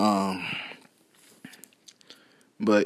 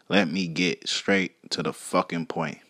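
ordinary talk, nothing marked

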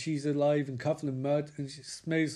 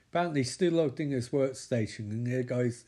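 ordinary talk

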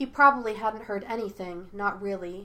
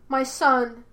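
loud, higher desperate cry; cried out; 4 semitones higher, 7 dB louder than usual. A narrator's reading. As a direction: call out to the son who is slipping away. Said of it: "the woman thing cried out".

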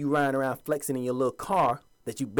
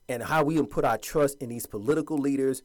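ordinary talk

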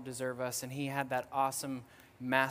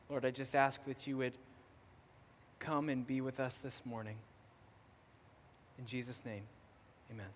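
soft, reverent prayer that fades toward the amen; earnest prayer; 2 semitones lower, 3 dB quieter than usual. Acted. Pastor praying before the sermon, reverent and hushed. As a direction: pray humbly to God for the church.

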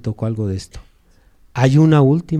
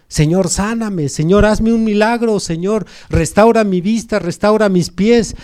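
high, pleading cries in a chain; crowd crying out; 9 semitones higher, 3 dB louder than usual. Acted. A multitude begs the Lord for healing, crying out. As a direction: call out urgent pleas, pitched well up. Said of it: "empiezan todos a gritar".

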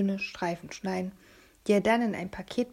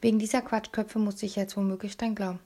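ordinary delivery, nothing marked

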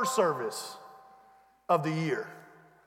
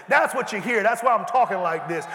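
ordinary delivery

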